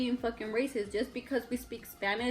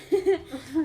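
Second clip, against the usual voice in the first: real laugh; 5 semitones higher, 4 dB louder than usual. Real, not acted. Laughs after accent joke.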